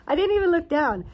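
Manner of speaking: loud and high, hammering the point home; exasperated exclaim. Real, not acted. Retelling her gas spill to viewers, loud emphatic repeat.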